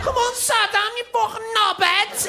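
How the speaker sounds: high, loud screeching hen-night yell; drunk Scouse girls shouting. Acted. Hen night girls yelling at Saddam, high loud screech.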